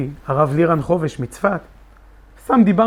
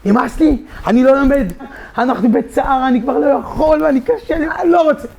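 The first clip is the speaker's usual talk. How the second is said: rising, louder, near-shouting refusal; upset outburst; 9 semitones higher, 3 dB louder than usual. Retold or imitated. To friends at the kollel, an agitated, rising refusal. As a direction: push it higher and higher in frustration. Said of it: "הייתי נסער".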